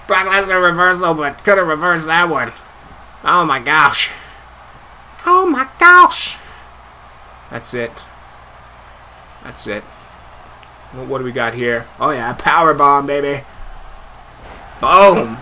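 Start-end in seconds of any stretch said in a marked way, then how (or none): none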